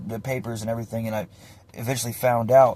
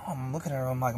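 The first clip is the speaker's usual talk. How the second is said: ordinary talk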